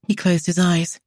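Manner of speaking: usual speaking voice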